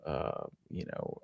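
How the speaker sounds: ordinary talk, nothing marked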